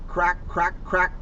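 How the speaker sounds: plain reading voice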